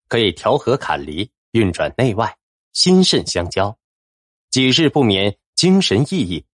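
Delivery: ordinary delivery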